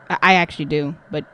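fast, low mumble; rushed aside. Real, not acted. Gamer muttering fast at the character on screen. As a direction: rattle it off under your breath.

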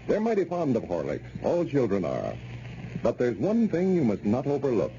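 ordinary delivery

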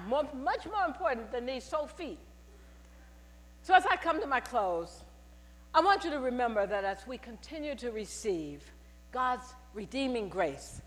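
usual speaking voice

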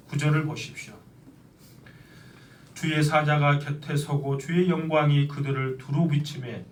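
ordinary talk

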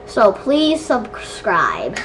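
slow, low, stretched plea; slowed-down sign-off. Pretend. Redoing the subscribe request after a gasp, slowly. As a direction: draw out each word, dropping low.

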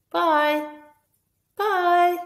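chirpy puppet farewell; high puppet goodbye. Acted. Puppet waving goodbye to the other. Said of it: "dialogue between them".

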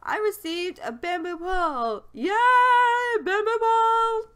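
high-pitched excited squeal of delight; gleeful cheer. Pretend. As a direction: cheer the new prize in a high voice. Celebrates receiving a fishing rod.

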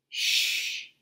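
long unvoiced-breath shh; hushing shush. Monkey shushing the sneezers so Mama sleeps, hushing.

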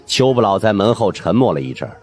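plain reading voice

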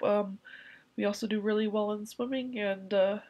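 ordinary talk, nothing marked

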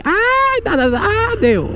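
sudden squeaky ai, dá dá dá; high startled yelp. Real, not acted.

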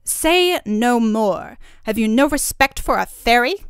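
loud, angry fairy, slow on the first words. A narrator's reading. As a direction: rebuke him with full indignation. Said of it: "cried she angrily".

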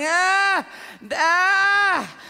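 high, stretched wordless wail; imitated child's struggling cry. Father imitates his son unable to speak, straining cry. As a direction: imitate a boy stuck on the first sound. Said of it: "He knows what he wants to say".

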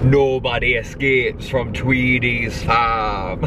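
slow, drawn-out Yorkshire film quote; Yorkshire accent. Acted. Quoting Chicken Run after seeing little farms, Yorkshire accent. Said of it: "That's my Yorkshire accent".

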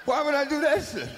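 high, loud, laughing outrage; outraged refusal. Retold or imitated. Willie yells at the director, high loud disbelief. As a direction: yell it like he can't believe it.

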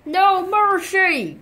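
slow, high battle cry; mock battle cry. Fox charging the wolf, a high battle cry. Pretend play, fox attacks.

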